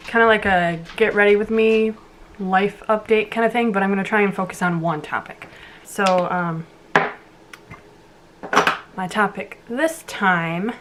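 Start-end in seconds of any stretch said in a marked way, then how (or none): none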